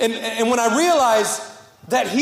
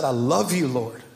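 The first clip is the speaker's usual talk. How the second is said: tearful prayer; 4 semitones lower, 4 dB quieter than usual. Retold or imitated. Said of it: "going to God once in tears".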